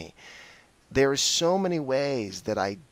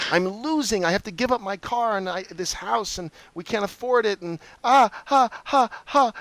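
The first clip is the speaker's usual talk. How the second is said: higher, self-pitying wail ending in a forced laugh; whiny victim; 9 semitones higher, 4 dB louder than usual. Retold or imitated. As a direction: play the poor victim to the hilt. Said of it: "went into my victim story".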